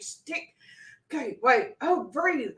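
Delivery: usual speaking voice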